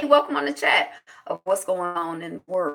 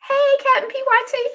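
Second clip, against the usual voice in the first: playful call; 4 dB louder than usual. Retold or imitated.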